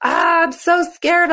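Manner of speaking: high, slow, hammed-up fright; mock scared. Gymnast after a scary fall, mock frightened and high. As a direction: play the panicked gymnast lightly. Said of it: "And you're like".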